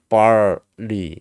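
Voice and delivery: "barley" drawn out in two parts with R; R kept in. Shown as a demonstration. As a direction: stretch "barley" with the R left in. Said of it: "R이 그냥 그대로 들어간다고". ("barley"의 R을 그대로 살려서 길게)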